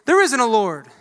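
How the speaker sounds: loud, flat denial; scoffing neighbor. Retold or imitated. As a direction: shoot her down loudly. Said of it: "the atheist would say".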